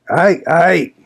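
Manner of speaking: high mocking old servant; gloating exclamation. A narrator's reading. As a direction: crow it like a spiteful old man. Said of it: "exclaimed Joseph".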